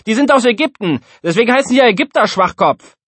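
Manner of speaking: high, loud, rapid comeback; squabbling schoolkid piling on an insult; indignant retort. A narrator's reading. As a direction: argue back fast and loud.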